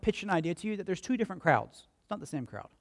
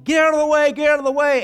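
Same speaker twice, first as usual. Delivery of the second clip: much higher, louder soldier's yell; yelling conquerors; 9 semitones higher, 9 dB louder, faster than usual. Retold or imitated. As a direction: bark it like a conqueror clearing the road. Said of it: "they'd be yelling".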